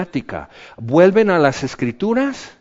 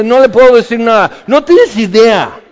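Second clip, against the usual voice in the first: loud mock frustration; 10 semitones higher, 12 dB louder than usual. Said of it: "O sea".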